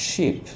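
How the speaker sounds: শিপ with a long, held ee; drawn-out sheep. Shown as a demonstration. Teacher to students, showing শিপ with a long ee. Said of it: "একটু লম্বা হবে".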